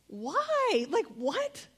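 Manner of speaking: high-pitched incredulous questioning; stunned, high squeal of a question; baffled disbelief. Retold or imitated. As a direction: cry out in bewilderment, pitch shooting up. Acts out disbelief at child's faith.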